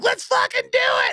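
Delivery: high screeching battle cry; hammed-up high shout. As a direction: imitate a rocker yelling, totally up for it. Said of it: "And he's like".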